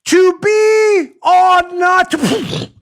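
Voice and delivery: high, slow theatrical Shakespeare recital; hammy Hamlet. Acted. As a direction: ham up the Hamlet soliloquy, drawn out. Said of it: "So they're doing Hamlet".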